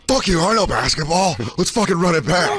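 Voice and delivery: high-pitched, hammed-up defiant challenge; imitating Lewis. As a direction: play a fired-up player demanding a rematch. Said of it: "What if Lewis comes back and he's like".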